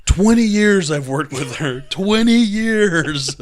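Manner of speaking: loud, slow exasperated outburst. Retold or imitated. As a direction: slam it down, fed up, slowly. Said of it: "slams all the paperwork down and goes".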